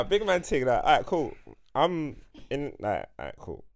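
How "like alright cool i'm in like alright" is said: usual speaking voice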